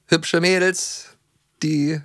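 usual speaking voice

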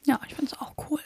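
soft unvoiced whisper. Real, not acted. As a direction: breathe the words. Whispered reply.

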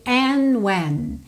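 "And when" with no D, "And" glided into "when"; silent D in and. Shown as a demonstration. Teacher linking "And when" smoothly. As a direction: drop the D of "And" before "when". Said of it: "Você não ouve o D do and".

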